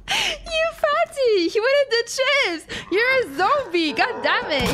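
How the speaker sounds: high, drawn-out playful scolding; mock outrage. Mock-scolding a zombie in game.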